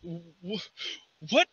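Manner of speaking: hushed, high, halting fear; frightened guard, barely getting words out; shaking voice. Acted. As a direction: stammer it softly in shock. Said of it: "shaking voice".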